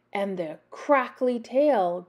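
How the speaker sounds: ordinary delivery, nothing marked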